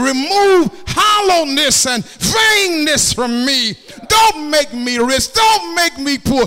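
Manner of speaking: high, shouted sermon climax; full-force preaching. Acted. Preacher begging the Lord for help through poverty, shouted preaching. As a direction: cry it out to the congregation and the Lord at full voice.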